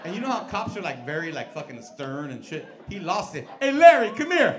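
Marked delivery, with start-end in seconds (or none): 3.6-4.6 loud, high shout through laughter; calling out laughing. Retold or imitated. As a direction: holler to your partner while cracking up. Cop laughing, calling partner.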